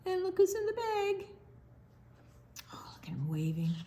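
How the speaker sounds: fast hushed delighted whisper; quick whisper. Real, not acted. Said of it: "whisper to kids".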